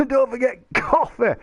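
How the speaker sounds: ordinary talk, nothing marked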